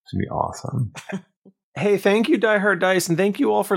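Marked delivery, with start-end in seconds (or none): 1.8-3.8 high, loud cheery thanks; excited thanks. Real, not acted. Outro sponsor thanks.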